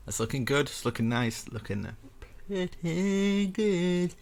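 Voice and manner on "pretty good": high, very slow cartoon-kid voice; squeaky kid cartoon voice; Cartman impression. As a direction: drag it out like Cartman. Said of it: "So I sound like Cartman there".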